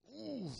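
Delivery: high admiring ooh sound; appreciative ooh. Retold or imitated. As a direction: let out a rising ooh at a pretty woman. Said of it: "I would make sounds, like, I would go".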